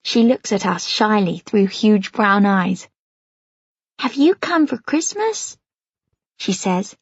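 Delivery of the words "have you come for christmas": put-on little girl's New York accent. A narrator's reading. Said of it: "in the cutest New York accent ever".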